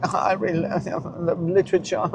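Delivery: lightly voiced smug reader, laughing; mock a proud reader through a laugh; mocking boaster. Mocking people who boast about reading, light smug voice. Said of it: "when people boast, like".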